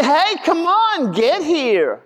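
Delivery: high, eager call across the room; light mock shout of come on, get here. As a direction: beckon someone over with a high call. Calling someone to come.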